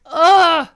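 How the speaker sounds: loud high ugh of disgust; disgusted groan. Retold or imitated. Listener reacts to Demeter.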